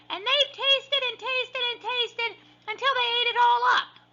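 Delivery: tiny bear squealing very high; baby bear squeak. A narrator's reading. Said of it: "squeaked the baby bear".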